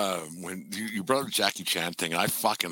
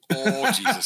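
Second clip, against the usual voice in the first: high, shocked "oh Jesus"; a hammed-up high cry of alarm; 9 semitones higher, slower than usual. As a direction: gasp it out in mock shock. Shocked reaction to the remark.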